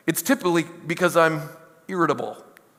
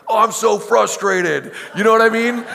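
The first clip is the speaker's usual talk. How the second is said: imitating daughter; 2 semitones higher than usual. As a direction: play the little girl stomping her fist down. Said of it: "She's like".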